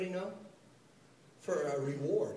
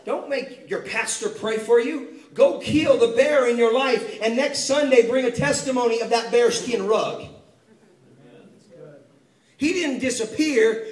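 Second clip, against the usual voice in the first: louder, driving sermon delivery; fired-up preaching; 5 semitones higher, 8 dB louder than usual. Acted. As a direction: preach it hard to the congregation. Preaching at full force.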